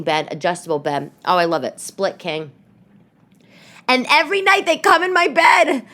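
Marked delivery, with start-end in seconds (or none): 3.9-5.8 high, loud mock complaint; exasperated exclamation. Real, not acted. Mother complaining about kids in her bed, exasperated. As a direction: wail it like a put-upon parent.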